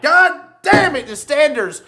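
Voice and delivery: high, loud mock-furious rant; mock outrage. Ranting to the hosts about standers dying out, high and loud. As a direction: rant like the standers' fate is a tragedy.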